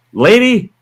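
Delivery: loud, high call across the room. Retold or imitated. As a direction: bark it to get her attention. Biker calls across waiting room.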